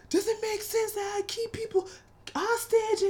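high, mocking voice; mocking his question. Vlogger parroting R. Kelly's question with a sneer. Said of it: "quoting his question".

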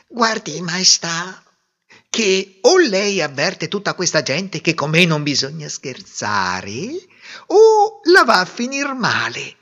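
high, building to a loud threat on the last words; a tiny mouse warning the king; menacing. A narrator's reading. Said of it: "Era così minaccioso". (minaccia del topolino che cresce fino alla fine)

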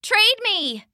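high, muffled underwater call. Acted. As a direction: shout it through the water. Jacob calls underwater.

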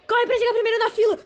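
high, loud laughing call; excited laughing shout. Acted. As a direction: shout it laughing, racing ahead. Correndo para a cantina. (grito animado rindo)

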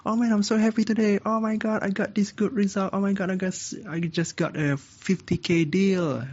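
high, rushing happy outburst; demonstrated excitement. Shown as a demonstration. Showing students how an excited tone should be, excited. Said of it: "if you are excited, what you say".

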